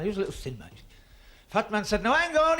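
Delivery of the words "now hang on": indignant shout. Acted. Fat man protests.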